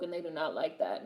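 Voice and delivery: hushed, breathless whisper of a secret. Retold or imitated. Reading a fan's comment aloud in a whisper. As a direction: whisper it like gossip.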